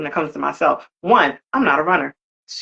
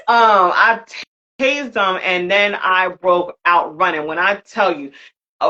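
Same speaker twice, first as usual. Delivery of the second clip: ordinary delivery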